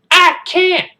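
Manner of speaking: high, loud protest; hammed-up child's yell; little-kid wail. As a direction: cry out desperately like a small boy. Kid wails back at sergeant.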